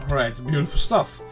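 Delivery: ordinary delivery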